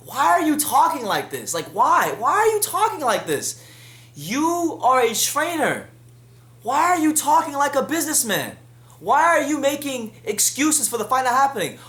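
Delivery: high, loud, incredulous outburst; real frustration aimed at the trainer; exasperated rant. Real, not acted. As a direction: demand answers from him in disbelief. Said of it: "it's really pissing me off".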